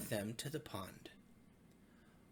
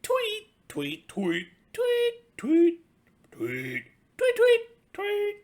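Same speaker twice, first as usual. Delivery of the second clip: high, loud chirping tweets; birdy tweets; 15 dB louder, much slower than usual. Acted. Little pig up in the tree chirping like a bird, high and loud. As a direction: chirp away like a songbird.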